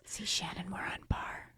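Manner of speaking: unvoiced conspiratorial whisper. Real, not acted. Telling her co-host they match, in a whisper. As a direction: breathe it like a secret.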